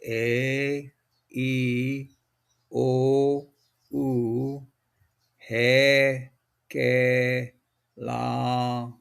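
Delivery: letters sounded the Hawaiian way, one by one; e, o, u, he, ke, la, mu, nu, pi, ve said slowly and clearly; teacher sounding each letter; Hawaiian letter sounds. Shown as a demonstration. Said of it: "the vowels are pronounced as".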